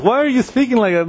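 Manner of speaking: acted rebuke. Peter rebuking Jesus for his words, mock indignant rebuke. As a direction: take him to task, pitch lifted. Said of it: "he began to rebuke".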